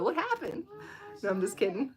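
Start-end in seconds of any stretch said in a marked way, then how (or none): 0.0-0.6 high-pitched jokey disbelief; hammed-up, high astonished question; mock surprise. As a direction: exclaim it in pretend shock, with a laugh. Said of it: "you would go".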